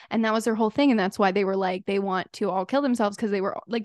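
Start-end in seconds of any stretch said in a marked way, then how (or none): none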